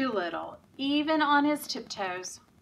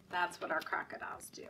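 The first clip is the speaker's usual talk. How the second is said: whisper; 5 semitones lower, 9 dB quieter, much faster than usual. Real, not acted. Teacher whispering to the children after the game. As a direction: drop to a secret whisper.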